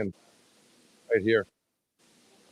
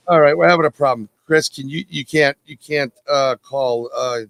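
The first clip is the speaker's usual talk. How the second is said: raised voice; 8 dB louder than usual. Real, not acted. Caller cannot get through.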